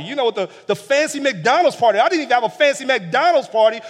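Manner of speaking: put-on older son ranting at his father about the party; whiny jealous son. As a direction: whine like a jealous brother. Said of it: "That's the translation".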